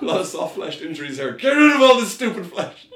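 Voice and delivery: rising into high, loud laughing yell; mock exasperated shout. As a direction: rant in comic disgust, ending in a yell. Acting exasperated bone expert.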